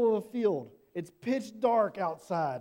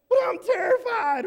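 much higher, loud panicked squeal; terrified cry; 10 dB louder than usual. Pretend. Shepherd pleading with the angel, high panicked cry. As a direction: squeal it out in terror. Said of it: "Like, I'd be terrified still".

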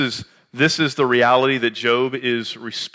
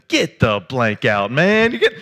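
mock disbelief; 4 dB louder than usual. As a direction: act out an old friend's stunned reaction. Friend's disbelief at hearing he's a pastor.

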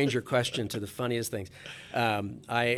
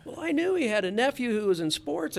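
higher, brightened, newly friendly; suddenly friendly; 10 semitones higher than usual. Retold or imitated. Manager warming to the agent's nephew, mildly excited. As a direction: warm right up to him. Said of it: "all of a sudden, we're best buds".

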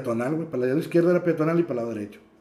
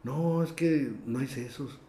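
slow, softer vendor's refusal; drawn-out refusal; 9 dB quieter, slower than usual. Retold or imitated. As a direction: stretch the words out like a reluctant vendor. Recrea la negativa del vendedor. (negativa lenta y suave de vendedor)

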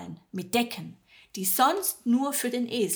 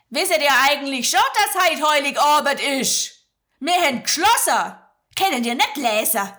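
loud, grumbling Swabian innkeeper snapping at them; grumpy innkeeper; 6 semitones higher, 9 dB louder than usual. Acted. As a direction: snap at the strangers at the door. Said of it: "raunzt der Wirt die beiden an". (schwäbischer Wirt, laut und grantig)

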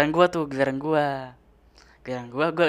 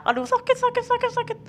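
very high-pitched repeated fake yelping; hammed-up squeal of pain; pretend pain cries. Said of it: "gue pura-pura".

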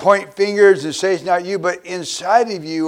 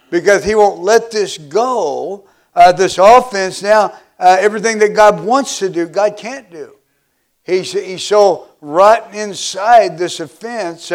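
fervent preaching; 4 dB louder than usual. Acted. Preaching at full force.

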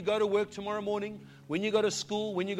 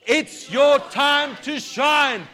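building from loud decree to shouted, higher praise; preaching at full force; 5 semitones higher, 10 dB louder, much slower than usual. Acted. Pastor leads the church in a rising decree, shouted. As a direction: proclaim the victory, rising to a roar.

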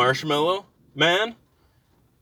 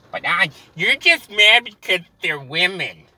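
sneering put-on critic voice, higher and louder; mocking critic; 6 semitones higher, 4 dB louder than usual. Imagined critic accusing him of sexism, sneering. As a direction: needle him like a smug online critic.

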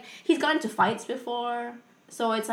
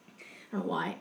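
whisper; 3 semitones lower, 8 dB quieter than usual. Real, not acted. Embarrassed aside about the call.

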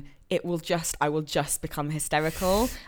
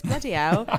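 ordinary talk, nothing marked

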